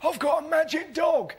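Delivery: high-pitched eager call; homeless bloke. Retold or imitated. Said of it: "he went".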